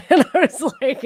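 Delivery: ordinary talk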